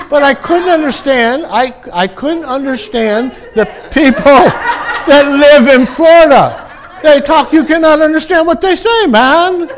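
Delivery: high, loud, laughing comic complaint; real laughter pushing the voice high and loud; laughing exasperation. Real, not acted. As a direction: crack up while ranting at the top of your range. Joking about incomprehensible accents, laughing.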